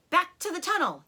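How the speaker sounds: high, loud command; order. Acted. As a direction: send them back quickly. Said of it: "he ordered".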